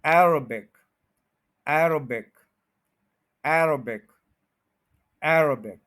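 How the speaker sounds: एरोबिक as "AIR-uh-bik", AIR punched; stress on first syllable. Shown as a demonstration. Teacher drilling Arabic, एरोबिक stressed on AIR. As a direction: hit AIR in एरोबिक, light schwa, then bik. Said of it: "एआर पे stress है".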